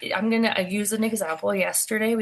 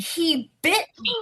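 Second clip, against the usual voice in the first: mock scream; 8 semitones higher than usual. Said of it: "she starts screaming".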